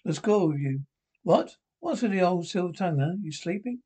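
ordinary delivery

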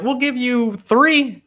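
high, slow mock offer voice; drawn-out teasing offer; mock stingy boss. Imitating Jordan contract offer.

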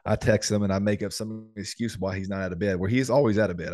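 ordinary talk, nothing marked

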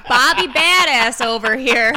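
slow, booming jeer over laughter; loud mockery. Real, not acted. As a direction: jeer loudly at a failed tough guy. Mocking the boastful customer.

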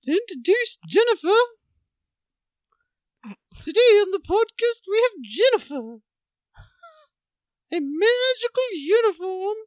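swooping, theatrical mock announcer; film-star impression. Host introducing Jennifer in a put-on swooping star voice. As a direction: introduce with hammed-up lilting swoops. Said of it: "use one of these voices".

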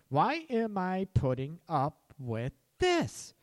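slow, drawn-out fed-up question; slowly spelled-out exasperation; emphatic exasperation. Acted. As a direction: stretch each word out, leaning on THIS. Same question repeated for emphasis.

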